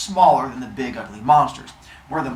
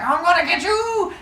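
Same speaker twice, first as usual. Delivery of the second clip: playful battle cry. Acted. Brother jumping off mountain.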